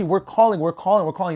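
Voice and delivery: usual speaking voice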